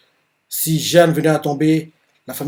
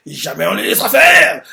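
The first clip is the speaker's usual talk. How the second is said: soldier's vow; 5 semitones higher, 5 dB louder than usual. Retold or imitated. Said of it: "L'autre no-name fait".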